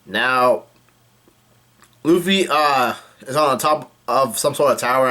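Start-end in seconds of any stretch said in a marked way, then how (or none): none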